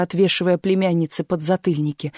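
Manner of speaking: plain reading voice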